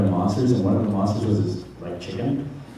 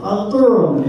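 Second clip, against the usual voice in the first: high-pitched chicken greeting; silly chicken voice; 9 semitones higher, 3 dB louder than usual. Retold or imitated. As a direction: say hello as a goofy high chicken. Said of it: "I would use that voice".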